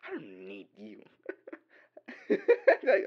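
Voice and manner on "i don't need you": softer mock dismissal; pretend cold rejection. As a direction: play it cool and quiet, half-joking. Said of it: "Imagine if I started like that".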